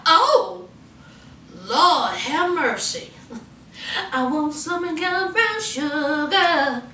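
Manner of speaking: slow crooning lovestruck man, half sung; smitten flirt. Retold or imitated. Bill spotting Mia and liking what he sees, slow sung flirt. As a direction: croon it slowly like he's smitten.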